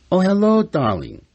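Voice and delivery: light up at the caller's voice; excited greeting. Retold or imitated. Wife answering the phone to a friend, high and gushing.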